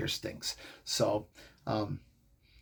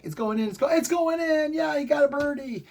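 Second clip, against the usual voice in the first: reliving a birdie, shouting it in; excited yell; 12 semitones higher than usual. Retold or imitated. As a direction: cheer the ball into the hole. Reliving Tiger's putt dropping.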